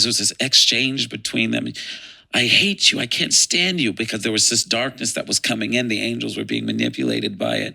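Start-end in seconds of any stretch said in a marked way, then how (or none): 2.3-3.9 louder, rising retold outburst; angry. Michael rejecting Lucifer in their exchange, angry. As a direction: spit out rejection, moderately heated. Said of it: "he was angry and he said".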